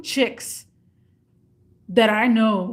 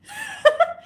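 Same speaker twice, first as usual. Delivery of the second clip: high laugh. Real, not acted.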